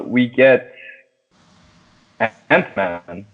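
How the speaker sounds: ordinary talk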